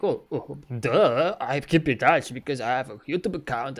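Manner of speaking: lightly mocked know-it-all reply; loud, drawn-out duh, mimicking the obvious answer; mocking dismissiveness. People asked how they keep in touch, mockingly dismissive.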